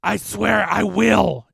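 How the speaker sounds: loud, slow, high monster vow; drawn-out demonic cry; choked-up specter. A narrator's reading. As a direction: force out the threat as if being strangled. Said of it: "its voice choked up".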